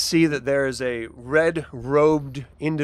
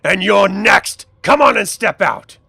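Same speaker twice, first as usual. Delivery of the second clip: loud, raised-pitch menace; threatening challenge; 6 semitones higher, 10 dB louder than usual. Acted. Gang captor orders adventurer to step out, loud threat. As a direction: call the intruder out to face you.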